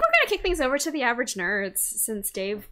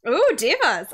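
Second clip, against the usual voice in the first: excited; 5 semitones higher, 8 dB louder than usual. Real, not acted. Excited about divas theme.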